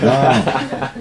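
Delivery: a high, laughing no. Retold or imitated. Friend retelling a stubborn refusal, laughing.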